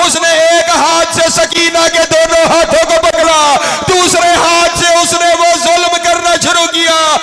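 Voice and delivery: high, loud, wailing majlis climax; slowing, weeping lament preaching at its peak; full-force lament recitation. Acted. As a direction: cry the tragedy out at full voice.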